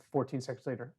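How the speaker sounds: normal talking voice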